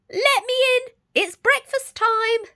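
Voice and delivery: high squeaky little voice, loud; squeaky wolf. Acted. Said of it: "squeaked the wolf".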